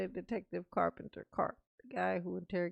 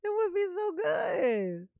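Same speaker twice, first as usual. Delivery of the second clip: mock crying longing; 10 semitones higher, 5 dB louder, slower than usual. Real, not acted. Longing for their fan-cast movie.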